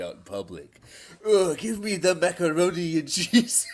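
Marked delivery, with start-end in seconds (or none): 1.3-3.7 higher, laughing put-on streamer voice; a hint of a surfer voice; mock surfer voice. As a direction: parody the streamer while laughing. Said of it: "the voice to be doing".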